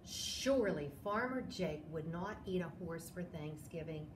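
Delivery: plain reading voice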